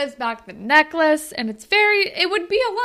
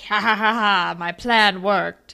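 villain laugh; 3 semitones lower than usual. Retold or imitated. Villain gloating quote.

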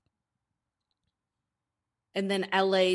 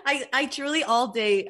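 ordinary talk, nothing marked

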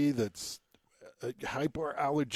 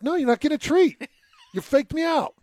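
high, loud mock scolding of dog; 13 semitones higher, 7 dB louder than usual. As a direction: tell the little dog off, high.

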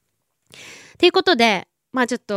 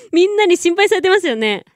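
laughing; 10 semitones higher than usual. Real, not acted. Laughing at worried fans.

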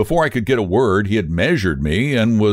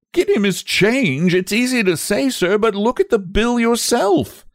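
high indignant retort; 10 semitones higher than usual. A narrator's reading. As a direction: throw it back at him, higher. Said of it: "Todd retorted".

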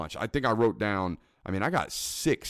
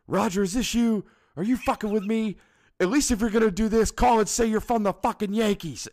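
much higher, louder, furious yelling at a prankster; angry shout into phone; 13 semitones higher, 4 dB louder than usual. Retold or imitated. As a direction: yell at the caller you think is fooling you. Retelling Jake Taylor's angry phone call.

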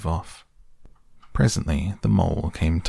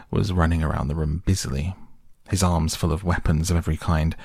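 plain reading voice

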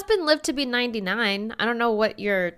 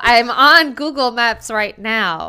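loud, insistent self-defence; real exasperated outburst; loud protest; 2 semitones higher, 11 dB louder than usual. Real, not acted. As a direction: insist over the teasing, voice raised. Defends himself against teasing.

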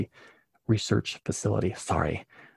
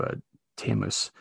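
normal talking voice

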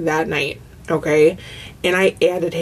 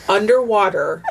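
usual speaking voice

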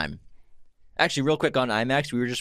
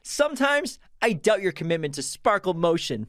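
stern coach quote; 7 semitones higher than usual. Acted. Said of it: "Let me say it again".

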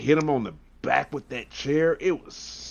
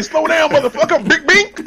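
mock Brock threat; 13 semitones higher, 5 dB louder than usual. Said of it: "He was like".

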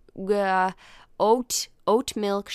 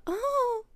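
nervous "oh". Shown as a demonstration. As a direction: demonstrate a small fluttery gasp. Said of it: "Not nervous in, like, a".

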